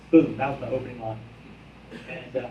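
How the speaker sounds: ordinary delivery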